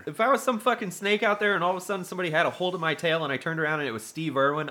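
ordinary talk, nothing marked

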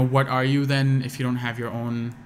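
ordinary delivery

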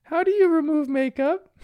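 very high put-on falsetto question; high-pitched voice. Made-up Bandit asks Chili for help, high-pitched. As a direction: imitate a dad squeaking as Mom. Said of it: "says in his high-pitched voice".

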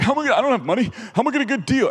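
panicked son. Retold or imitated. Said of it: "He's like".

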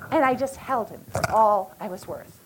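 ordinary talk, nothing marked

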